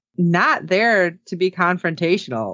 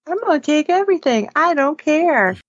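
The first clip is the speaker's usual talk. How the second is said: high-pitched mock whine, slowing at the end; whiny kid; 8 semitones higher than usual. Podcaster mocks a character as a little whiny kid, whiny imitation. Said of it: "a little whiny kid".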